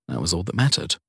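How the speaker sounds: ordinary delivery, nothing marked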